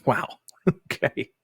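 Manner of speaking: ordinary talk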